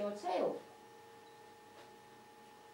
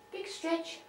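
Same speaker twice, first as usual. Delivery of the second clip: talking to a pet; 6 semitones higher, 7 dB quieter than usual. Real, not acted. Coaxing pet bird to stretch.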